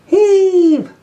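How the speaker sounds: very loud, high heave call; shouted heave. Acted. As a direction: bellow it as everyone pulls. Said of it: "shouted Percy".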